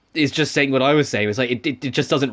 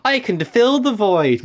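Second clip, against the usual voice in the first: excited high voice; 9 semitones higher, slower than usual. Retold or imitated. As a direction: proclaim it like an eager hero. Mimicking a character's triumphant line.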